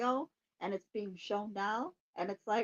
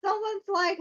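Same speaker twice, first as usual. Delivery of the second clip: normal talking voice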